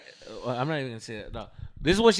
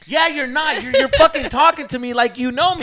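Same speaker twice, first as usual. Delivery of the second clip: loud, high acted-out retort; getting loud; 10 semitones higher, 9 dB louder than usual. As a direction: argue back in a raised voice. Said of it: "Why are you getting loud".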